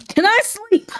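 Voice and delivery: pleading in a squeaky high voice; high plea. Acted. Qing Yu begging to sleep, high mock plea.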